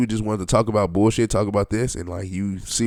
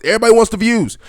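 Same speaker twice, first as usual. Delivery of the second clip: raised, higher-pitched shouty emphasis; mock yelling; 12 semitones higher, 8 dB louder, faster than usual. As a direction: start yelling to mock the screamers. Mocking screamers, building up.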